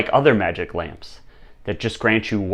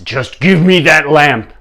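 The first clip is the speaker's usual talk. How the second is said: louder, higher, insistent order; impatient demand; 6 semitones higher, 8 dB louder than usual. Acted. King pressing the refusing monk for his lamp, impatient. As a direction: push the demand out hard.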